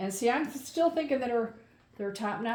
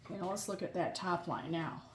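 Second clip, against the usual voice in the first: normal talking voice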